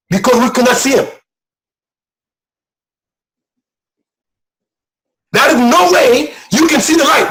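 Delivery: fervent pulpit shout; preaching at full force. Real, not acted. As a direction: drive it home to the congregation. Preaching climax, rising.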